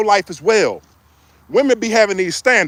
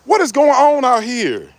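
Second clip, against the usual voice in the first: disbelief; 4 semitones higher, slower than usual. Retold or imitated. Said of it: "And I'm like".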